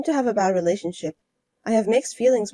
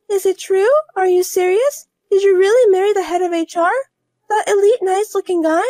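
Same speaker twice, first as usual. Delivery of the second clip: higher and louder, in disbelief; higher voiced woman hearing who her rival married; shocked rival wife; 10 semitones higher, 6 dB louder than usual.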